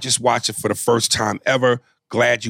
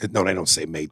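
usual speaking voice

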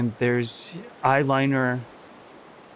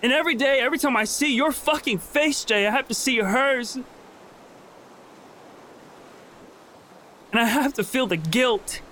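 much higher, mocking then breaking; anguished bitter confession; 9 semitones higher than usual. Lizzie throwing her secret guilt at Jay, anguished and high. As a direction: spit out the confession she never made.

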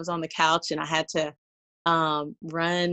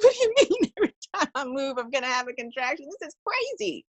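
high, fast, laughing disbelief; incredulous outburst; 8 semitones higher than usual. Retold or imitated. Labouring mother hearing every move brings a contraction, high incredulous laughing protest. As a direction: react as if the news is absurd.

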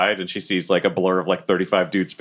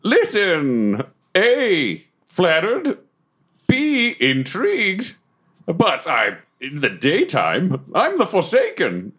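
higher, slow theatrical delivery; brooding self-styled dark suitor; 9 semitones higher, much slower than usual. Acted. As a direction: swoop grandly through each phrase. Plays Deathwolf on a date.

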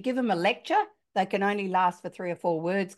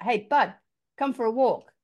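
raised voice calling a son outside; 4 semitones higher, 4 dB louder than usual. Retold or imitated. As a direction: call out cheerfully across the house. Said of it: "he's called out".

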